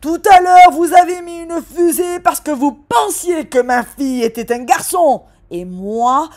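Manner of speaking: a father bellowing at full volume; yelling dad. Acted. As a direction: outshout the waitress. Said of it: "papa a hurlé".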